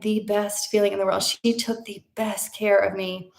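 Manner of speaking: ordinary talk, nothing marked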